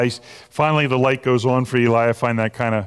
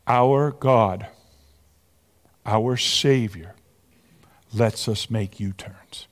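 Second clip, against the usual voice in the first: slow, hushed and lower, fading to near whisper; tender, sincere; 4 semitones lower, slower than usual. Real, not acted. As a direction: let it sink in gently, slowly. Tender point after the joke.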